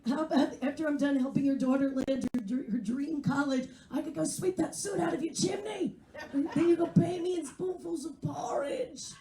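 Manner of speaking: hammed-up British accent rising to a shout. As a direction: sneer it back in a British accent. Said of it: "exactly how I say it, with a British accent".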